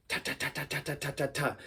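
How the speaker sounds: quiet, crisp ta ta snare hits; snare ta beat. Shown as a demonstration. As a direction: tap out a quick ta snare. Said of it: "That's the snare".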